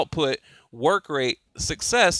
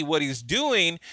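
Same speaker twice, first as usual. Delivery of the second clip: usual speaking voice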